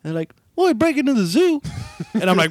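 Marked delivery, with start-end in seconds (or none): 0.6-1.6 higher-pitched, lightly voiced local buddy; imitated buddy. Buddies answering what they do for fun, imitated buddy voice. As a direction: toss it out higher, as a buddy's boast.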